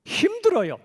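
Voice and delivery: playful high reply on their behalf; mock answer. Answers own question for audience.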